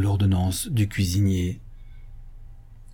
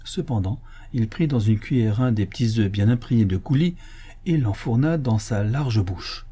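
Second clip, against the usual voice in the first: ordinary delivery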